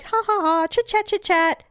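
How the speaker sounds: mock chit-chat voice. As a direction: parody breezy chatter. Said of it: "I elevate my voice at that time".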